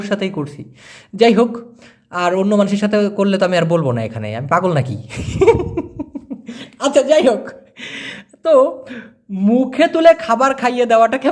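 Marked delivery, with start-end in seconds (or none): 5.0-6.8 airy unvoiced chuckle at his own joke; a quick breathy laugh after joking. Real, not acted. Teacher laughs at his own joke, airy chuckle.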